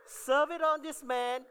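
high, loud, hammed-up Italian waiter accent; second try at Italian accent. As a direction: belt it out like a mock Italian waiter. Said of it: "Sorry, wrong accent".

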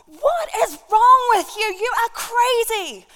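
high, loud furious shriek, slowing at the end; screaming at husband. Acted. Wife screaming at her husband after the shooting, high furious shriek. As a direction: scream at him that he's mad. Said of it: "And she screams to her husband".

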